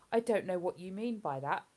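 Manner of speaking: ordinary talk, nothing marked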